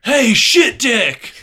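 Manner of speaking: high, slow barked insult; yelling sergeant. Retold or imitated. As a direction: bark it like a drill sergeant. Drill sergeant yelling at recruit.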